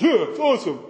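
mock dopey enthusiasm; dopey kid. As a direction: put on a dim, enthused kid voice.

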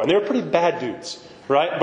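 ordinary talk, nothing marked

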